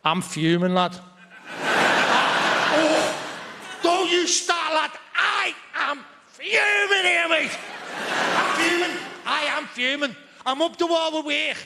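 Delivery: furious Scouse lad, shout "I am fuming here"; angry Scouse. Pretend. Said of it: "the second state of Scouse being".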